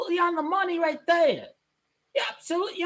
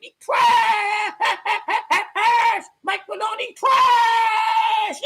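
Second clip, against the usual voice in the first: taunting shout; 5 semitones higher than usual. Real, not acted. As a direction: yell it at the rival like a heckler. Taunting rival team's player.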